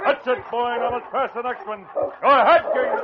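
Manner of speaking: eager shout from the sled; calling out. Acted. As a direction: push the team past the next sled. Musher urges dog to overtake.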